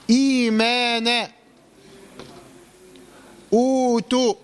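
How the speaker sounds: slow recited إيمانا، أوتوا with long "ee" and "oo"; madd badal examples. Shown as a demonstration. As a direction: chant إيمانا، أوتوا, stretching each long vowel. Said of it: "يبقى اسمه مد إيه؟".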